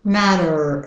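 American "matter" ending in er; drawn American R on "matter"; American pronunciation. Shown as a demonstration. Teacher shows the er in matter, American accent. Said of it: "the American er".

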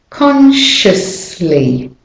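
"Consciously" very slowly, CON-shus-lee; careful syllables. Shown as a demonstration. Teacher models S to L for learners, slow deliberate "Consciously". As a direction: draw out "Consciously", easing the S into the L. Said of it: "going from S to L in that word".

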